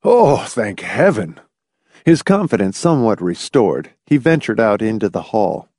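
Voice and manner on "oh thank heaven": slow, drawn-out flood of relief; old man's heartfelt relief; relieved. A narrator's reading. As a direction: let out the worry on a long "oh". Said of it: "Relief flooded Tillingford's face".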